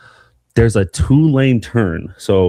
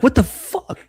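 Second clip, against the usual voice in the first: high, startled what the fuck; shocked outburst; slower than usual. Retold or imitated. Driver just run off the road, yelling in shock. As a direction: recoil in disbelief at the crash. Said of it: "I'm like".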